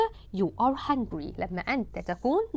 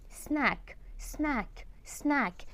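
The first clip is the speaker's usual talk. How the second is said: soft repetition; 3 semitones higher, 9 dB quieter, slower than usual. Shown as a demonstration. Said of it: "soft repeated drill".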